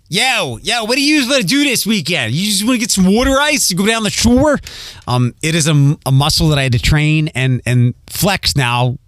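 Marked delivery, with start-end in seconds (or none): 0.1-4.6 higher-pitched, hammed-up Philly accent; Philly buddy making weekend plans. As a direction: put on a thick Philadelphia street voice. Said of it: "my Philly accent comes out".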